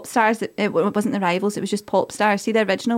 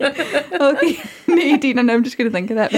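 giggly and higher; laughing; 4 semitones higher than usual. Real, not acted. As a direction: laugh your way through it. Giggling after the name mix-up.

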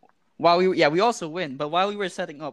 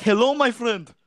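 higher, louder mock Arabic-accented greeting; 5 semitones higher, 4 dB louder than usual. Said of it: "some Arabic, like, accent".

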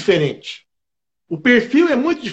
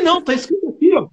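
incredulous protest; 9 semitones higher, faster than usual. Retold or imitated. Retold protest to the guard.

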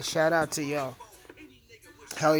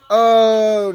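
loud exclamation; 7 semitones higher, 16 dB louder than usual. Real, not acted. Startled exclamation before joking.

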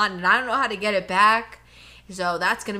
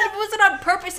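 higher and louder, pressing on "it wasn't on purpose"; emphatic protest; 9 semitones higher, 5 dB louder than usual. Real, not acted. To her friend, denying it was deliberate: high, loud protest. Denying she'd trip the kid.

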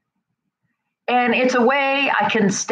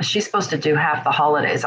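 ordinary talk

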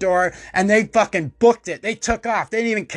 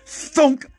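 thunk sound effect; 8 semitones higher than usual. Retold or imitated. Scissors hitting leg.

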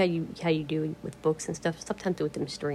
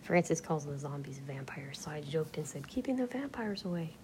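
hushed, breathy-free whisper, close to the mic; quiet whispered talk; soft whisper; 8 dB quieter than usual. Acted. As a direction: whisper it like ASMR chat. Whispered joke retelling.

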